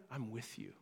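hushed, low promise; quiet reassurance. Acted. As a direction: drop down soft and intimate. Voicing God speaking to congregation.

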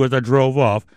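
ordinary talk

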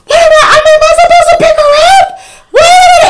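frantic, very high, loud wail; pleading with God. Retold or imitated. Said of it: "it was me talking to God".

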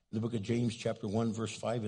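ordinary talk, nothing marked

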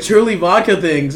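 usual speaking voice

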